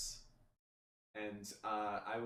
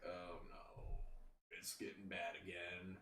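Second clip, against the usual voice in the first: sick dismay; 5 semitones lower, 9 dB quieter than usual. Retold or imitated. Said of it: "I was just like".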